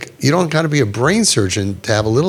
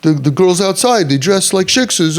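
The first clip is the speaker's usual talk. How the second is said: higher-pitched imitation of self-justifying people; defensive protest; 6 semitones higher than usual. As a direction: protest innocence in a put-on insistent voice. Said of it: "People will say that".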